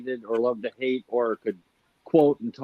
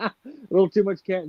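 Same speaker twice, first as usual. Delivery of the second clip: laughing; 8 semitones higher, 4 dB louder, faster than usual. Real, not acted.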